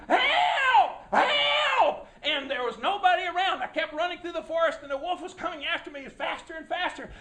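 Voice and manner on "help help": high, loud screams for help; loud, high panicked screams for help; screaming for help. Retold or imitated. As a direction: scream it as you run from the wolf. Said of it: "I ran and screamed".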